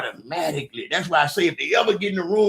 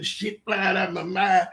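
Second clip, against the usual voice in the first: ordinary delivery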